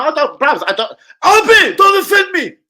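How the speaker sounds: high, loud offended yell; indignant shout. Real, not acted. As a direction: protest loudly at the chat. Indignant rant at chat.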